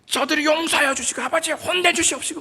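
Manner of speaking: high, hammed-up angry pleading with a laugh in it; mock angry prayer. Preacher mimicking an aggressive pray-er, mock angry. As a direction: pray like scolding God. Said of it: "막, 막 이렇게 화, 화낸".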